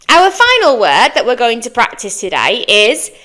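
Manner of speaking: normal talking voice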